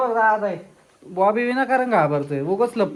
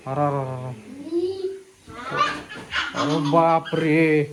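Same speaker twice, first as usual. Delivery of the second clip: mock dread; 5 semitones lower, 4 dB quieter than usual. Parent playing ghost hunt with Bobby, mock dread. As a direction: feign dread, dropping the voice low. Feigns fright at the moving ghost.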